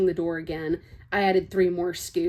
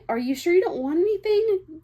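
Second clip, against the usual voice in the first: cheery pushy waitress; 11 semitones higher, 3 dB louder than usual. Acted.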